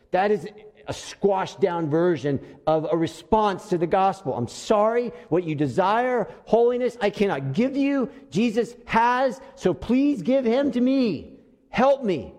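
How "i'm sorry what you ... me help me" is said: pitched-up, imploring acted prayer; earnest plea. Unbeliever begging God for forgiveness, earnest plea. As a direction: plead for help, leaning on each phrase.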